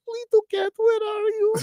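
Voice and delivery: very high calling mock Finnish voice; a neighbor sweetly calling her lost cat; imitating neighbor. Neighbor calling for her little cat from the porch, high-pitched imitation. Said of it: "she's always".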